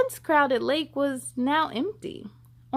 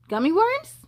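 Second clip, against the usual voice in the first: hopeful question; 6 semitones higher, 3 dB louder than usual. Acted. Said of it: "Pip hoped".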